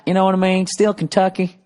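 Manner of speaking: usual speaking voice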